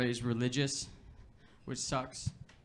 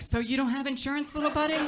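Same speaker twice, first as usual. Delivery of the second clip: much higher cooing voice to a pet; baby talk to dog; 11 semitones higher, 3 dB louder than usual. Retold or imitated. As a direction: coo at the little dog. Receptionist talks to the dog.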